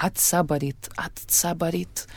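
soft, drawn-out Russian-accented imitation. Daughter quoting her mother insisting she is native-born, Russian accent. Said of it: "זה כזה עם כל המבטא".

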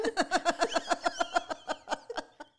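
high breathy burst of laughter; high giggle. Real, not acted. Laughing while telling a friend about the recital gaffe. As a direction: laugh at your own blunder.

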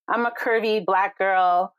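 normal talking voice

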